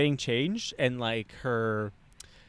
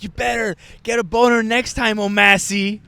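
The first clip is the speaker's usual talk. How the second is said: higher, louder mock telling-off; scolding girl; 5 semitones higher, 7 dB louder than usual. Girl warning him after a failed first night, mock scolding. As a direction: voice her loud warning, pitched up.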